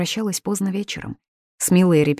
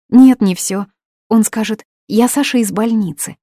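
eager insistence; 6 semitones higher, 8 dB louder than usual. Acted. Mila insisting to administrator.

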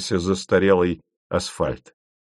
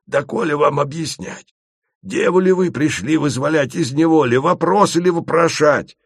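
indignant Asen; 6 semitones higher than usual. A narrator's reading. Asen scolds the travellers for asking questions. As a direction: lecture them in outrage. Asen's voice.

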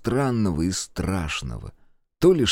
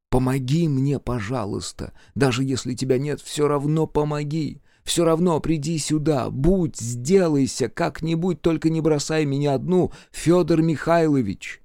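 desperate pleading; 6 semitones higher than usual. A narrator's reading. Desperate tearful prayer.

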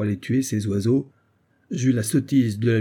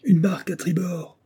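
higher-pitched lookout call; sailor's sudden cry; 7 semitones higher than usual. A narrator's reading. Sailor alerting the ship to a boat to starboard, sudden cry. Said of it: "s'écria tout à coup un matelot".

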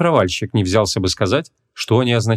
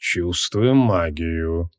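low, slow, hoarse wizard; 5 semitones lower, 4 dB quieter, much slower than usual. A narrator's reading. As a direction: rasp it out softly and very slowly. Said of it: "хрипло сообщил маг".

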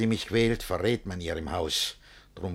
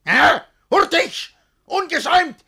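very high, loud rapid orders; barked commands; 8 dB louder than usual. Acted. Master demanding an answer at once, high loud barks. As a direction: bark each word at them.

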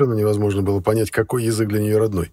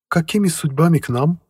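housekeeper voice; 8 semitones higher, 5 dB louder than usual. A narrator's reading. Housekeeper asks.

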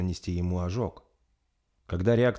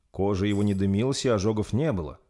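plain reading voice

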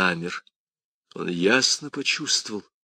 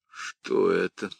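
ordinary delivery

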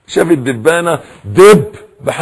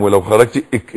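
ordinary delivery

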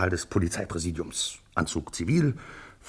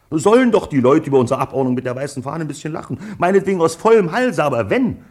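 laut und nachdrücklich; 7 semitones higher, 6 dB louder than usual. Acted. Police chief insisting on the plan.

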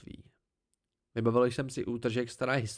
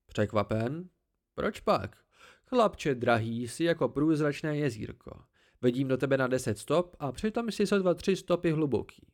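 haughty tree creature; 2 semitones higher than usual. Acted. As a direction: talk down to the boy from the branches, pleased with yourself.

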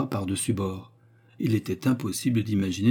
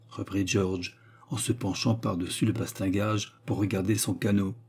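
usual speaking voice

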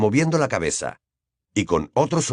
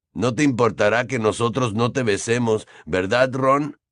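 ordinary delivery, nothing marked

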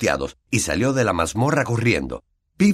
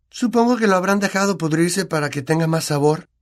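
put-on higher, know-it-all girl voice; knowing air; 10 semitones higher than usual. A narrator's reading. Hermione lecturing her friends, knowing and pitched up. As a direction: say it like the expert in the room. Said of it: "dijo Hermione con aire de entendida".

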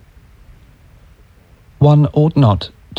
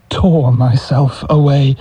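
usual speaking voice

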